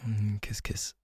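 a hushed, intimate kiss, kiss; whispered kiss. Real, not acted. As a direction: softly breathe the words kiss, kiss. Intimate kisses, soft.

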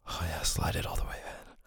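breathy unvoiced whisper, close to the mic. Lover to partner in bed, whispered. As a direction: breathe it out without voice. Intimate roleplay, whispered line.

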